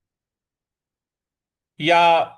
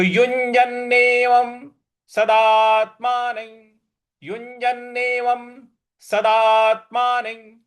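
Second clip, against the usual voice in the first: higher, careful Sanskrit recitation; model recitation; 6 semitones higher, 4 dB louder, much slower than usual. Shown as a demonstration. Teacher models the full phrase, careful higher recitation. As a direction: recite the line as the class should. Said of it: "इस प्रकार से इसको उच्चारण हमें करना है".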